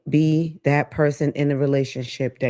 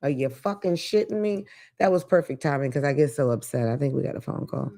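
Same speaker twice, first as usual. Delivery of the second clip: normal talking voice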